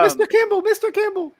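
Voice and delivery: high squeaky calling out. Pretend. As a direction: call out in a tiny voice.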